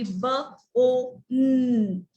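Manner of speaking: "B, O, N" as separate sounds, with pauses; long "oh", then a held "nnn"; segmented phonics demo of "B, O, N"; sounded out letter by letter. Shown as a demonstration. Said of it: "they could read".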